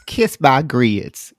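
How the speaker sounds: slow, exaggerated Southern drawl on grits. Co-host shows off his best Southern drawl, slow and hammed-up. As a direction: ham up a Southern drawl, stretching every word. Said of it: "that's about as Southern as I can get".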